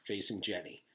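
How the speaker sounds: ordinary delivery, nothing marked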